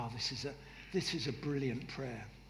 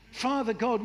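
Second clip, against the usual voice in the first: ordinary delivery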